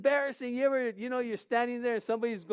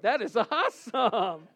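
laughing; 4 dB louder than usual. Retold or imitated. Retold joy at police escort.

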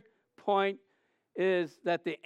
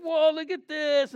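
high amazed whoa; amazed whoa, look at this; 8 semitones higher, 3 dB louder than usual. Retold or imitated. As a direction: point it out, amazed. Awe retold.

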